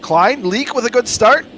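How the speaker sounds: high, loud race call; excited play-by-play. Acted. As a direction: call the holeshot with energy. Live play-by-play.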